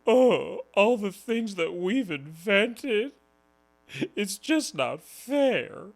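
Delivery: high, slow whiny mock voice, fake-sad; mock whining. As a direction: whine it like a sulking victim.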